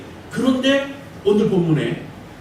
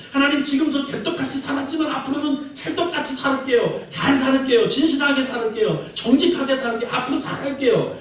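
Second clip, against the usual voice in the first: higher, eager vows to God; earnest promising prayer; 6 semitones higher than usual. Retold or imitated. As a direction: promise fervently, begging. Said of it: "이렇게 기도하는 거 지혜로운 거예요". (높고 간절하게 다짐하는 기도)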